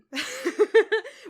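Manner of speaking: high, loud burst of laughter; sudden high laugh. Real, not acted. Laughing at the cut moment.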